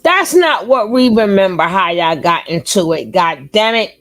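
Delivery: loud, challenging retort; loud accusing challenge; heated pushback. Real, not acted. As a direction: call him out loudly. Argues with guest.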